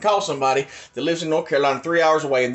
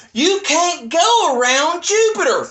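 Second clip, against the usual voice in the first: high, slow mocking echo; mocking quote; 13 semitones higher, 5 dB louder, slower than usual. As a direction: parrot the critic's words with scorn. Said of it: "Nerve Gorilla described it".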